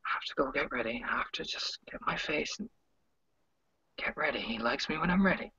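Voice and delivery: soft unvoiced murmur to herself; muttering whisper. [name] murmuring to herself while smiling at Alex, whispered. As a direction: whisper it under a smile. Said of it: "you can hear her going".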